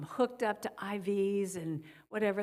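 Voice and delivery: ordinary delivery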